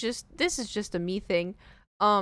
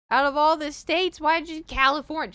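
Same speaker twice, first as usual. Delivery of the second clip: higher and louder exasperation; exasperated; 8 semitones higher, 5 dB louder than usual. Real, not acted. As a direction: vent about shortening California. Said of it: "exasperated rant".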